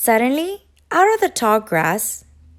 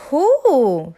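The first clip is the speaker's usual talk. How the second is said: squawking; 6 dB louder than usual. A narrator's reading. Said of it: "squawked Thistle".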